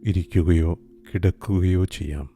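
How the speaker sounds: usual speaking voice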